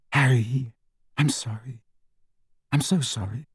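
put-on quiet, remorseful girl's voice; hushed and sorry, almost breaking; soft apologetic. Hermione apologizing after disaster.

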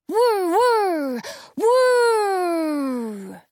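high, loud whirring noise; loud blender whir. A narrator's reading. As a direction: whir like a roaring machine. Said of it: "It's getting louder".

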